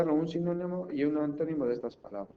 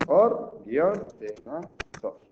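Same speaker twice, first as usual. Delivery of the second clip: split into syllables; 2 semitones higher, 7 dB louder, slower than usual. Shown as a demonstration. Teacher showing one way to divide the word, syllable by syllable. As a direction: dictate the word piece by piece with pauses. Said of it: "puedo hacerlo así".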